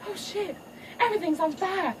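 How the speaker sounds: put-on high startled girl voice; mock panic. Daughter caught in fire, high mock panic. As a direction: gasp it up high.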